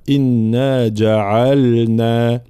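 slow, drawn-out إنا جعلنا with distinct ل; clear lam. Shown as a demonstration. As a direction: hold the nasal in إنا and sound the ل in جعلنا. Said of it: "لابد من بيان اللام إظهارها". («إنا جعلنا» بإظهار اللام)

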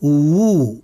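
و said as a full, lip-rounded wu; careful isolated wu sound for و; waw with damma. Shown as a demonstration. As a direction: round the lips fully and hold و as wu. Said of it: "نضم الشفتين ضما كاملا".

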